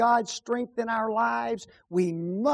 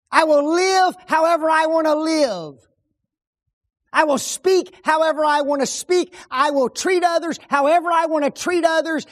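loud, stubborn, higher defiance; defiant believer; 8 semitones higher, 6 dB louder than usual. Pretend. A believer defying the preacher, loud and stubborn. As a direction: throw it back at the preacher. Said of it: "We don't like being told".

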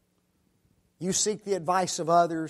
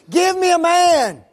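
much higher, much louder roar; full roaring giant's scream; 13 semitones higher, 10 dB louder than usual. Retold or imitated. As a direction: scream the challenge at the top of your voice. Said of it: "And he just screams it".

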